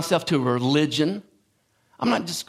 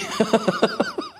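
high burst of laughter. Real, not acted. Laughs at own quip.

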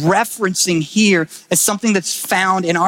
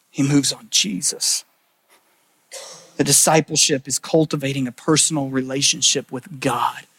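hushed preaching; 6 semitones lower than usual. Acted. Pastor to congregation on Jesus as the ladder, hushed and lower.

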